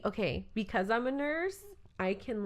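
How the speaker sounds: ordinary talk, nothing marked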